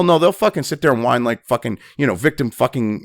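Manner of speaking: ordinary talk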